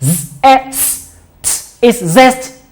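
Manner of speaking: very slow letter-by-letter blending; sounded out slowly. Shown as a demonstration. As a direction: stretch each sound, then join into "zest". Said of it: "I have four sounds now".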